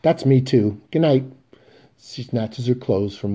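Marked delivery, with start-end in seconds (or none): 0.0-1.2 louder, higher curt goodbye; a stung woman leaving; clipped hurt farewell, louder; angry, humiliated. Acted. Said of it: "The woman angry, humiliated".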